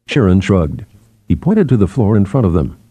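ordinary delivery

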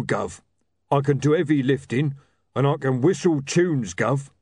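a simple heavy who calls everyone Gov; dim-witted troll bouncer. Acted. Troll Rocky pleading with William for a job.

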